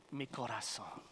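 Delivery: low, soft "Mi corazón" with a Spanish r; tender Spanish. Shown as a demonstration. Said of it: "Gentlemen, you say this".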